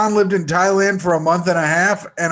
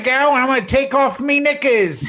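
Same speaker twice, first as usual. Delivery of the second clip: hammed-up Kiwi accent; joking, broad mock New Zealand voice; 3 semitones higher than usual. Co-host playing the New Zealand girl, hammed-up Kiwi accent. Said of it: "Okay, you be the New Zealand girl".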